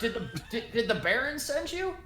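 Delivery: high, hesitant questioning voice; flustered homeowner, pitched high; anxious older woman. Acted. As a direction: stumble into a high, worried question. Game master plays the older woman.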